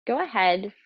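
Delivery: ordinary delivery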